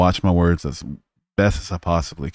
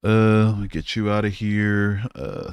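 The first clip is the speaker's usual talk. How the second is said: ordinary talk, nothing marked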